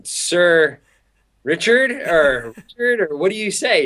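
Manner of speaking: high, drawn-out tentative questioning; mock hesitant, stretched and high; unsure how to address. Acts out uncertainty over addressing Branson.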